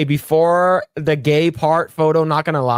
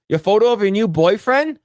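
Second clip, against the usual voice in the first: ordinary talk, nothing marked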